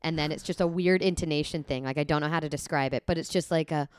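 normal talking voice

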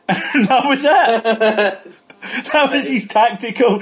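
can barely get it out for laughing. Real, not acted. Laughing at his own story.

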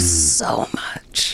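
soft whispered aside; mother dodges, she whispers back; whispered retort. Retold or imitated. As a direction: breathe it out, exasperated.

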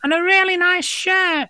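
very high squeaky little voice; high-pitched voice complimenting a haircut; tiny voice. Acted. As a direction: squeak it like a peanut. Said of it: "hears another voice".

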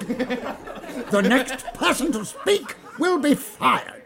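slow, high, loud warning; stern threat over laughter. Acted. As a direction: spell out the threat. Manager threatening slowly.

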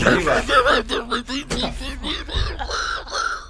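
long high, soft wheeze of laughter; helpless tearful laughing fit. Real, not acted. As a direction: lose it laughing, almost crying. Cracks up mid-list.

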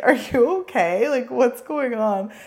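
usual speaking voice

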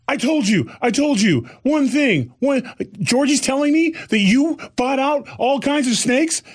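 hammed-up scolding wife voice; mock scolding. Wife telling off husband over the snakes, high exasperation. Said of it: "I'm like".

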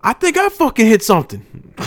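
high, loud put-on dad voice; imitated dad. Son retelling dad's car trouble, imitated dad. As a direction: act out dad's alarmed announcement. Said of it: "my dad's just like".

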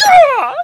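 high loud yelp; excited yell. Retold or imitated. O'Brien landing on a transported horse, yelling. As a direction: whoop as you land. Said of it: "is like".

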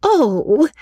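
very high, knowing 'oh'; Miss Steele's cry. A narrator's reading. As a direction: squeal a drawn-out high exclamation. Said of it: "cried Miss Steele".

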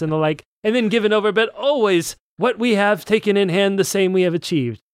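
high, hammed-up kingly declaiming; pretentious storybook royalty; grandiose story reading. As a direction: read it aloud with mock royal pomp. Reads archaic royal dialogue aloud.

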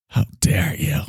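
a hushed, breathy mock Greta; lightly done Greta imitation; Greta Thunberg voice. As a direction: whisper the outrage like a Greta parody. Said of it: "now you sound like Greta Thunberg".

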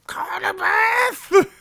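high, drawn-out mock shout; hammed-up calling out. Shopkeeper stuck in his doorway shouting after the girl, hammed-up calling out. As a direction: wail the name out in a comic high voice. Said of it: "stands in the doorway and goes".

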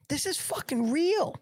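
high, amazed exclaim; stunned it actually exists; incredulous. Real, not acted. Disbelief at the Target ornament.

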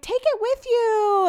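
high, drawn-out singsong plea; mock wail to a departing guest; calling out. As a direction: call it after someone leaving, stretched high and loud. Calling after departing friend, retold.

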